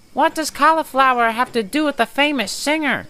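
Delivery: high squeaky little engine puzzling aloud; squeaky Percy. A narrator's reading. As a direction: squeak it like a small cheeky engine. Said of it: "squeaked Percy".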